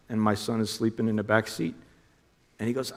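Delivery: ordinary delivery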